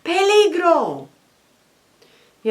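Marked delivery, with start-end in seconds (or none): 0.0-1.1 high, loud, slow alarm cry; frantic herdsman calling out danger; shouting danger. Acted. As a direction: cry out a warning to the village. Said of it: "empieza a gritar".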